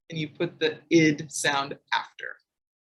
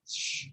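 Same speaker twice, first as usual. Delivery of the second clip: sh hiss; 14 dB quieter than usual. Shown as a demonstration. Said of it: "and the sh sound".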